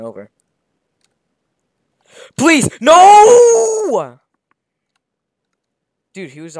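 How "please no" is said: desperate scream. Acted. Old man screaming as he falls.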